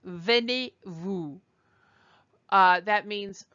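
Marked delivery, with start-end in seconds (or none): 0.0-1.4 "D'où venez-vous?" spoken slowly, syllable by syllable; slow, clear model of "D'où venez-vous?"; slow careful French. Shown as a demonstration. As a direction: spell it out slowly for learners. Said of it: "D apostrophe O U".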